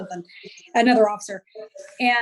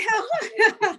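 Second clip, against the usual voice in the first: laughing outrage; 8 semitones higher, 3 dB louder than usual. Retold or imitated. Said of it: "I was pissed".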